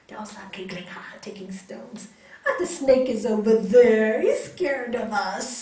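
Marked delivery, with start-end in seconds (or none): 2.5-5.5 put-on children calling out. Children telling the swami of the scared snake, loud calling. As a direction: call it out loudly as boastful children.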